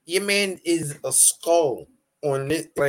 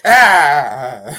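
mock cry of pain; 9 dB louder than usual. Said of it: "He was like".

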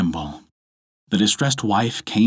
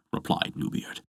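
usual speaking voice

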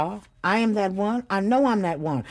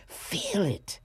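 whisper; 4 semitones higher, 6 dB quieter than usual. Real, not acted. Urging the audience to feel it.

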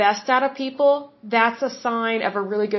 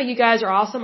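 usual speaking voice

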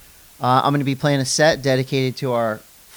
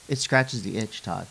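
ordinary delivery

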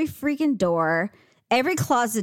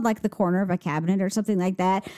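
normal talking voice

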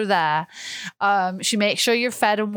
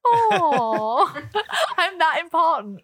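mock self-important; 7 semitones higher, much slower than usual. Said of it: "and I was like".

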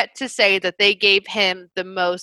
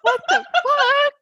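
high, through helpless laughter; laughing disbelief. Real, not acted. Host reacting to the ridiculous accent, laughing hard. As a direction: crack up while asking, pitched way up. Cracking up at the imitation.

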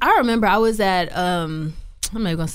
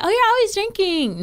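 mocking gossip voice; 13 semitones higher than usual. Mocking what people say.

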